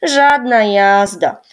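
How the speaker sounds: dismissive and unbothered; shrugging. A narrator's reading. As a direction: toss it off with a shrug. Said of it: "wzruszył ramionami Rysiek".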